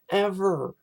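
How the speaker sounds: usual speaking voice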